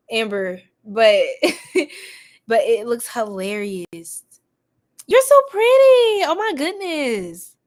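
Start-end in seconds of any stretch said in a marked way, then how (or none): 3.9-7.6 gushing. Real, not acted. Gushing over viewer's photo.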